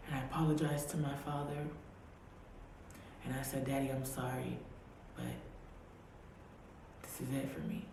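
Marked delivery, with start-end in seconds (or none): 3.7-7.9 softer, fading goodbye; soft farewell. Retold or imitated. Apologising to her father at the grave, soft and fading. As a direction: let it trail off to a whisper.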